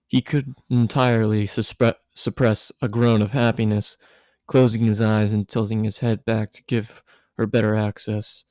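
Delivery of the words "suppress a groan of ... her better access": plain reading voice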